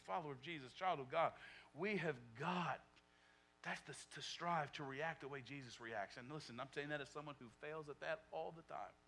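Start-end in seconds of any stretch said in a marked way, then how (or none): none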